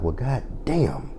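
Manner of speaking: hushed slow disbelief; soft stunned aside. Retold or imitated. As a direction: let it drop out under your breath, slowly. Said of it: "I'm like".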